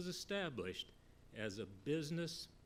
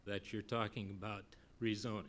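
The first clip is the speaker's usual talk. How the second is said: ordinary talk, nothing marked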